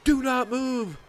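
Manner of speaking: high, loud, urgent retold scream; screaming cop. Cop to crash victim on the ground, screaming. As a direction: imitate a cop yelling orders at full tilt. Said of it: "screaming at me saying".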